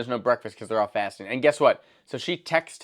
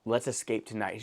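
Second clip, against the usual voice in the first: much softer, hushed invitation; soft plotting; 5 semitones lower, 9 dB quieter than usual. Retold or imitated. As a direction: coax him gently, almost whispering. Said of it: "she starts off soft".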